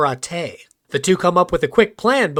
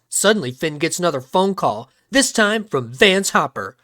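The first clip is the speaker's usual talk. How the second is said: ordinary delivery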